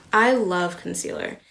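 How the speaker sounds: loud, slow, drawn-out love; gushing. Real, not acted. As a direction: stretch it out with big enthusiasm. Gushing about favorite product.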